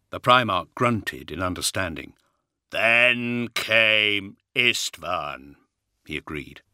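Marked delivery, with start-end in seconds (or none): 2.7-5.6 very slow, booming giant's voice. Acted. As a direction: rumble it heavily like a giant. Primarch agrees.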